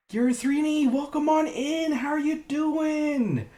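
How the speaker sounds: high, loud, drawn-out welcome; high sing-song host voice, slow on the last line; sing-song greeting. Acted. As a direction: call out a big high welcome to an old friend. Greets the arriving character.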